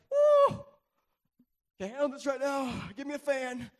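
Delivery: loud, high, breathless whoop; overwhelmed excitement. Pretend. Preacher acting out meeting God, loud overwhelmed whoop. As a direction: blown away, fanning yourself. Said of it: "I'm gonna be like".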